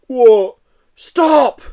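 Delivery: loud high alarm; alarmed shout. Acted. Joe and Yeti shouting in alarm, loud high. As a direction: cry stop in panic.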